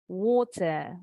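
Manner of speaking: water in British accent, waw-tuh with a crisp T; British water with silent final R; British pronunciation. Shown as a demonstration. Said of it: "Kalau orang Inggris". (water logat Inggris, tanpa bunyi R)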